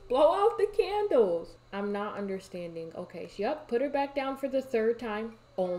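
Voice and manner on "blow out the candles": high, loud impatient command; exasperated order. Real, not acted. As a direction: yell it at someone not listening. Exasperated order to game characters.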